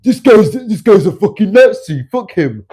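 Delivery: mock the haters as brainless cavemen; exaggerated dumb-guy parody, shouted; mocking dimwit. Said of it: "So you're gonna be like".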